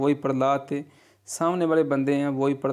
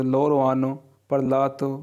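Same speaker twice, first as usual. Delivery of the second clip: very slow, each Italian word laid out clearly; slow model reading; much slower than usual. Shown as a demonstration. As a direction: read it slowly for learners to copy. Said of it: "teacher models Italian phrase". (धीरे-धीरे साफ़ उच्चारण)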